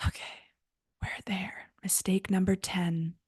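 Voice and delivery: hushed whisper, almost no voice. Real, not acted. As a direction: let it slip out as a secret whisper. Whispered aside at video end.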